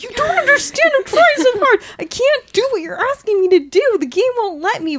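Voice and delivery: desperate, freaking out at the character; frantic pleading. Retold or imitated. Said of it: "freaking out in my seat".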